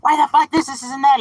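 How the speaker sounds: high, loud mock yelling; mock angry customer yell. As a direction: ham up a rude customer shouting. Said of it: "you yelling at the top of your lungs".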